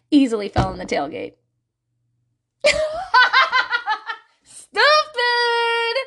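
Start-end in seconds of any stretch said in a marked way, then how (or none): 2.6-4.2 loud high cackle; helpless high-pitched laughter; real laugh. Real, not acted. Laughs at her own story. 4.4-6.0 high, drawn-out singsong exclamation; high sustained mock scolding; self-mocking cry. Real, not acted. Mocks her younger self, laughing.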